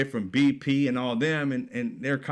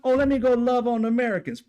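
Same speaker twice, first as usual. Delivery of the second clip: high, louder mock sweetness; mock sugary line; 8 semitones higher, 3 dB louder, faster than usual. Mocking what America wants Iran to say, high sarcastic tone. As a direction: sing it out sarcastically. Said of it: "America's gonna be like".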